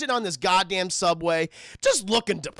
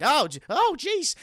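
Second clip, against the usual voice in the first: higher, mildly startled mock reaction; a little spooked; 6 semitones higher, 4 dB louder than usual. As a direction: act slightly spooked. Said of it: "she gets a little spooked".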